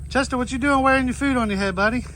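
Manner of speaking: high, quick pet talk to a goat; cooing at a goat with food on its head. Real, not acted.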